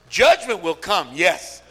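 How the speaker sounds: normal talking voice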